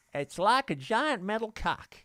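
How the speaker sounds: usual speaking voice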